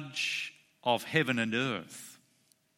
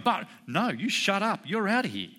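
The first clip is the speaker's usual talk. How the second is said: higher-pitched mock judge shutting down a protest; courtroom cut-off; 7 semitones higher than usual. Judge Judy silencing the protesting young punk, mock imperious. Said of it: "he's going".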